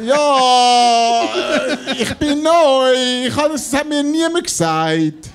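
slow, high, drawn-out sheepish whine; sheepish excuse. Retold or imitated. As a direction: plead it like the new guy.

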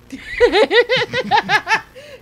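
bursting high-pitched laugh; cracking up loudly, high. Real, not acted. Laughing at son's answer.

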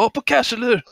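very high fake-eager question; trolling fanboy. Troll asking if it was Cash, high eager fanboy voice. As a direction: press him in a squeaky mock-fan voice. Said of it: "Man bara".

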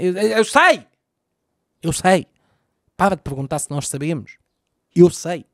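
mock fed-up protest at the listener; exasperated outburst. Irritado com "sabem" repetido.

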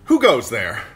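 loud, sudden challenge; calling out. Acted. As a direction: call it into the dark woods. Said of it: "the lordling called out suddenly".

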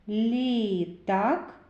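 "Літак" with a long "і"; vowel stretched. Shown as a demonstration. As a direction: hold the I in "Літак" before "так". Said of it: "Звуковий аналіз слова".

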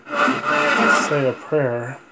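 drawn-out, very slow dark spirit imitation; imitating a dark spirit voice. Ghost hunter mimicking the spirit box voice, very slow and dark. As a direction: stretch each word like a dark spirit would. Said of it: "It sounds a little bit dark".

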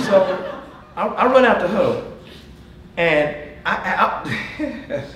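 talking loud through laughter; laughing storytelling. Real, not acted. Coach laughing while recalling the Giants game. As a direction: crack up as you tell it.

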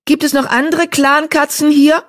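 high, searching call. Acted. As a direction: call out to anyone in hearing. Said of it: "rief Blattpfote".

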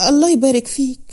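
ordinary delivery, nothing marked

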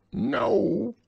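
high, loud put-on squeak; disguised voice. A narrator's reading. Rabbit disguising his voice to fool Pooh, high and loud. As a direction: pretend to be someone else, way up high. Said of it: "in a different sort of voice this time".

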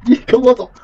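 high, loud bossy call; playful command. Pretend. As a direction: order them along in fun.

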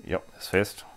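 ordinary talk, nothing marked

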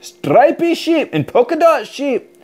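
usual speaking voice

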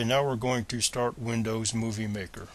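ordinary delivery

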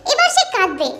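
ordinary delivery, nothing marked

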